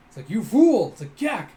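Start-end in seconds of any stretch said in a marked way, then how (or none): 0.3-0.9 loud, high burst of scolding; acted angry rebuke. Acted boss berating. 1.2-1.5 high, loud choked yelp; imitated startled gak. Imitates character's choked cry.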